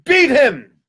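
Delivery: high, emphatic order; high command, sweeping down; upset duke. Acted. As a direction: cry out the sentence in outrage. Duke orders second beating.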